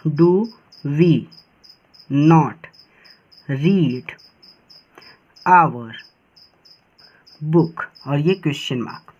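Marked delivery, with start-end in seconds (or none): 0.1-7.8 each word spaced out with long pauses; slow dictation, a pause after every word. Shown as a demonstration. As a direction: dictate it one word at a time for copying. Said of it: "चलिए इसको do करते हैं".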